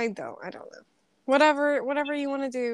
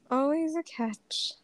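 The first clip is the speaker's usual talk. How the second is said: hushed repeat; 3 semitones higher, slower than usual. Real, not acted. Repeats it after laughing.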